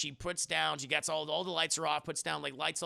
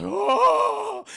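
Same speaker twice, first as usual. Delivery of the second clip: loud, high "oh" of shock; hammed-up startled wail; shocked gasp; 13 dB louder than usual. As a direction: cry out at seeing a ghost's message. Acting out friend's shock.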